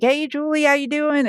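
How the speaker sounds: high, sing-out hello, imitating the family; cheery greeting. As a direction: greet an old acquaintance in a high voice. Family greeting her at the door.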